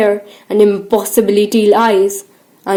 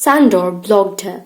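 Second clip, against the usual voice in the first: ordinary delivery, nothing marked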